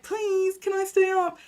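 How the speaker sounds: put-on high, begging little kid voice; pleading child. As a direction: beg mum like a small child. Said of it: "saying to my mum".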